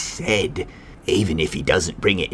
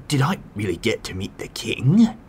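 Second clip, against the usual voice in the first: ordinary talk, nothing marked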